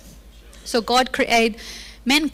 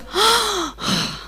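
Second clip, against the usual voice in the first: gasp of horror; 7 semitones higher than usual. Acted. Horrified at hearing mother.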